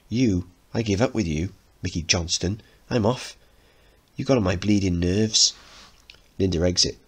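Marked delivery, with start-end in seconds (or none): none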